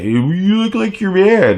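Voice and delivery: high put-on voice of a pestering woman; mocking woman's voice. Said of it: "And she kept at it".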